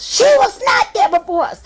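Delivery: high, loud shriek; screaming friend. Retold or imitated. Drunk friend screaming at her husband, high loud shriek. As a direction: shriek the denial.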